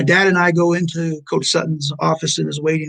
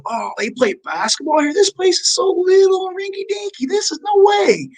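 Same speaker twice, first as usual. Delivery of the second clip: much higher, far-off voice, scoffing at the tiny gym; mom's scoffing voice; 14 semitones higher than usual. Retold or imitated. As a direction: play the tiny spitfire mom sneering at a little building. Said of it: "the first thing I hear her say".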